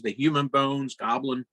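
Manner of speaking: ordinary talk, nothing marked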